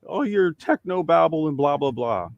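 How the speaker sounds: high, loud, scornful brush-off; dismissive whine. Retold or imitated. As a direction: wave it all away, annoyed. Voicing dismissive people.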